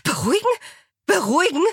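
high, incredulous rising question; outraged echo. A narrator's reading. Alexa echoing Angela's plea, high outraged disbelief. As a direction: throw the word back in indignation. Alexa throws the word back.

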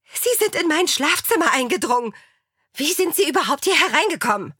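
high-pitched outraged accusation; indignant. A narrator's reading. Alexa accusing the intruders, high indignation. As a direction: rant in a high, affronted voice.

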